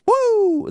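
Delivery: high-pitched wrestler's "woo!"; imitated Flair woo. Co-host mimicking Flair's shout on the mic, high whoop. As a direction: let out a long, high whoop. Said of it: "after Flair shouts".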